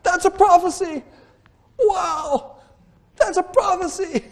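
a shouted wow of discovery; excited yell. Retold or imitated. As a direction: blurt it out with sudden wonder. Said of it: "I yelled it out".

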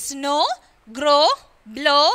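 Snow, grow, blow with a long "oh"; O as in tomorrow. Shown as a demonstration. Teacher reading the tomorrow list to pupils, long O demo. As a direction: model Snow, grow, blow one at a time, ending on "oh". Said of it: "pronounced as in tomorrow".

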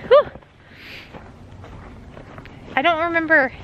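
ordinary delivery

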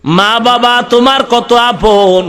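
ordinary talk, nothing marked

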